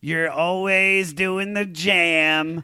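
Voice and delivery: slow, loud, high ceremonial voice; mock solemn speech. Honoring friends at his bar mitzvah candle lighting, slow and hammed-up. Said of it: "when I'm like".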